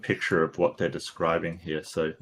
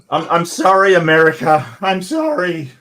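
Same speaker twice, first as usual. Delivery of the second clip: high, loud, hammed-up plea; mock-desperate apology; 9 semitones higher, 9 dB louder than usual. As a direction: wail an exaggerated apology to a whole nation. Mock apology to America for mispronouncing.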